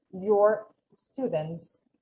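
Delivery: "students" with the final S sounded clearly. Shown as a demonstration. Teacher models the plural S for the class, slow and clear. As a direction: land on the S of "students". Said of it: "Es muy importante pronunciar esa S".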